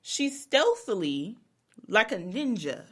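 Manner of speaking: usual speaking voice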